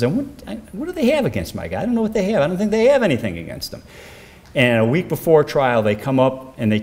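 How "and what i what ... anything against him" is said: much higher voice, quoting a puzzled defense lawyer; high-pitched quoted lawyer. Retold or imitated. Lawyer wondering what they have against his client, high-pitched imitation.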